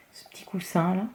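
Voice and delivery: murmure attendri, presque sans voix; glisse-le comme un petit secret; chuchoté. Real, not acted. Aside about a little cushion. (chuchotement en aparté)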